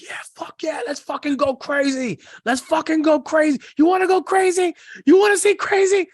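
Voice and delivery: high, loud, building psyched-up yelling; maniac hype yell. Retold or imitated. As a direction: scream it like a maniac behind the wheel. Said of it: "drive like a maniac and be like".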